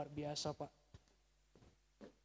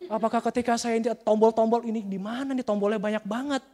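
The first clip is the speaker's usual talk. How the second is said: loud, high excited gawking; hammed-up marvelling; 6 semitones higher, 14 dB louder than usual. Preacher acting himself facing the car's many buttons, hammed-up marvelling. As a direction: play dazzled at a fancy dashboard, loudly.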